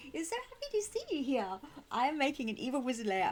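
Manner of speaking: ordinary talk, nothing marked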